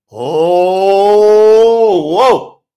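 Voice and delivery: long drawn-out, loud "Oh", then "whoa"; swelling exclamation of amazement; astonished reaction. Acted. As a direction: react as if stunned by the verse. Mock shock at the verse.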